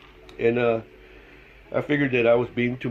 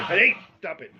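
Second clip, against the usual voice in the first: higher-pitched call to the dog; real scolding call; 6 semitones higher than usual. Real, not acted. Calls out to noisy dog.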